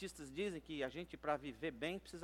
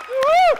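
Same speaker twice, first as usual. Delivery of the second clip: very high, loud "woo-hoo"; piercingly high cheer; celebratory whoop; 32 dB louder than usual. Real, not acted. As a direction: whoop with the crowd. Cheering along with applauding congregation.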